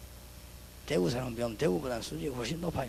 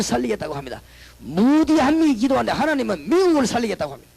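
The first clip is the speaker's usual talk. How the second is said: loud, high-pitched revival preaching; full-force preaching; 6 semitones higher, 8 dB louder than usual. Acted. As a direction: preach it out with full conviction to the congregation. Preaching on prayer's power. (힘찬 설교 톤)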